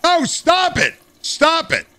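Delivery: high, frantic stop it; frantic protest. Real, not acted. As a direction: yelp at the prisoner to stop. Prisoner peeing on him.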